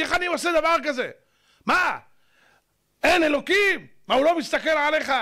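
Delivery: high-pitched, insistent reproach; indignant scolding. Acted. Lecturer scolding one who came to learn character, indignant. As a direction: fire rhetorical questions at them, pitched high. Mock scolding of the doubting listener.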